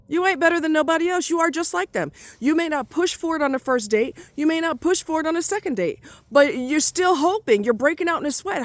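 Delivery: high-pitched heated scolding; heated rant. Real, not acted. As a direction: lay into him, voice climbing. Ranting at the man.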